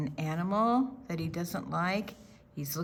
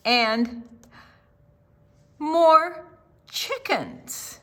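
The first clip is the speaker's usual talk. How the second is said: louder and higher item by item; building excitement; 4 semitones higher, 9 dB louder, slower than usual. A narrator's reading. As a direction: pile on the animals with rising wonder. Story building up the animals.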